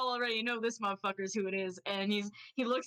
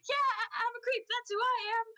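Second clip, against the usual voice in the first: much higher, squeaky blurted admission; flustered confession; 14 semitones higher, 3 dB louder, faster than usual. Acted. Bath blurts a guilty admission to the rooster, squeaky and high. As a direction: cave in and own it. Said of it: "Bath is like".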